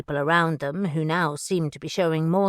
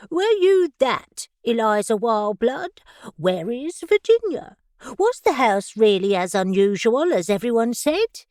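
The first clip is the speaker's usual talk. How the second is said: high, lilting posh society voice; singsong upper-class mimicry; sing-song posh voice; 4 semitones higher, 5 dB louder than usual. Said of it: "She adopted a sing-song posh voice".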